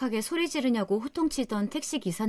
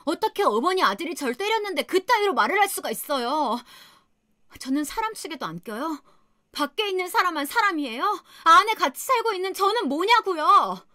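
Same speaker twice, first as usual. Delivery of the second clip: furious yelling; 7 semitones higher than usual. Retold or imitated. Daughter-in-law yelling back at her mother-in-law, rising to a furious shout. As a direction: let the rage climb until it breaks. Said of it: "정신이 나가서 막 소리를 질렀나 봐요".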